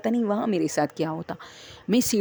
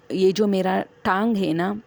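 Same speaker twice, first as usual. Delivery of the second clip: usual speaking voice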